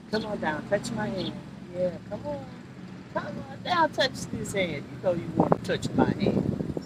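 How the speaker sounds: hushed, tender sweet talk to a tree; soft sweet talk. Real, not acted. Praising the tree she touches, hushed sweet talk. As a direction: woo the tree softly.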